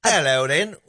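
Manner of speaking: jaunty mock British greeting; put-on British hello; British accent. Shown as a demonstration. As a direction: demonstrate a cheeky British accent. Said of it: "say something in a British accent".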